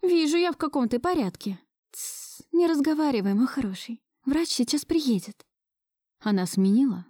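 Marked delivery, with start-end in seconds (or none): 0.0-5.5 higher-pitched, chiding then hushing; concerned woman. A narrator's reading. Marusya hushing injured Gleb until the doctor comes, concerned.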